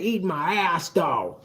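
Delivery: low, soft, drawn-out taunt; low sultry taunt. Retold or imitated. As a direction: purr it low and teasing. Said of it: "The way she was saying".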